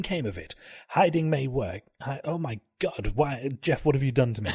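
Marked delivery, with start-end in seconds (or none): none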